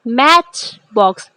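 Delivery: मैच box with मैच stretched long; stress on MATCH. Shown as a demonstration. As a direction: lengthen the first syllable of मैच box. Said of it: "मै की sound जो है वो लंबी". (मैच box में मैच को लंबा खींचें)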